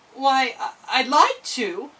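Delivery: put-on unsure girl stalling; hesitant. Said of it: "she answered hesitantly".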